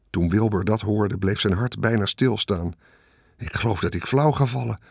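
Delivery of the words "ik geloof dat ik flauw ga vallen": ordinary delivery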